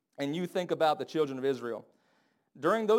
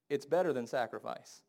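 ordinary delivery

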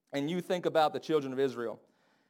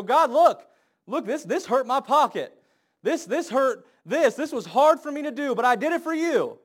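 much higher, louder mock whine with a laugh; whining to God; 8 semitones higher, 8 dB louder than usual. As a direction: complain to God like a whiny child. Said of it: "they go".

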